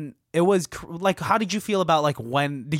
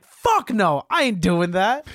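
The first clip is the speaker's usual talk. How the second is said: imitating an emphatic, indignant refusal; louder, higher mock refusal; retold firm refusal; 4 semitones higher, 5 dB louder, slower than usual. Co-host retells the spy refusing one more mission, emphatically.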